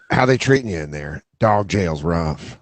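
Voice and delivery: a dog's weary jailhouse voice; mock prison visit. Justin visits the jailed dog, low gruff reply. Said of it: "He goes".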